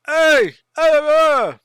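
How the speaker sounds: high, loud summoning yell. Acted. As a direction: yell across the camp for someone to come. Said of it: "he yells".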